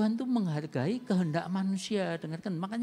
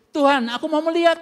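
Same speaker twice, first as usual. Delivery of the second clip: loud, higher plea to be heard; desperate cry; 7 semitones higher, 14 dB louder than usual. Acted. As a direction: cry out like a blind beggar begging. Blind beggar cries to Jesus.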